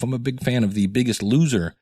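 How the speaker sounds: normal talking voice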